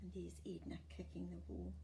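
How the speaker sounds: soft whisper about the picture; whispered aside. Real, not acted. Reader points at picture.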